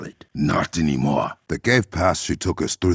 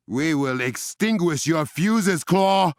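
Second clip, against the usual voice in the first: defiant shout; 10 semitones higher, slower than usual. Acted. Defiant battle cry at villain.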